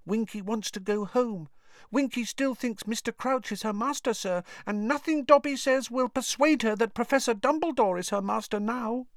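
high elf voice, hushed and sorrowful; sad whisper. A narrator's reading. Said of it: "Dobby whispered sadly".

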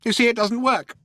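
high little mouse voice; interrupting mouse. A narrator's reading.

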